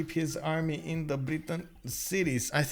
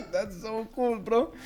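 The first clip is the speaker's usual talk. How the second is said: excited laughing whoa; 8 semitones higher, 4 dB louder, slower than usual. Real, not acted. Excited reaction to a notification.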